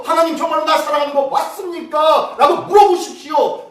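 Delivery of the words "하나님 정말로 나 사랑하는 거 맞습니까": loud, high pleading cry; desperate shouted prayer; crying out to God. Shown as a demonstration. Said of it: "부르짖어 보십시오".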